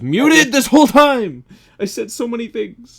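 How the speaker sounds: high, loud shocked exclamation; indignant outburst. Real, not acted. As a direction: realise you were muted all along. Discovers mic was muted.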